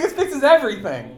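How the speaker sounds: high, loud laughing line; laughing delight. Retold or imitated. Retelling the Mario costume calming the customer, laughing. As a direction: squeal it through laughter, delighted. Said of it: "I was like".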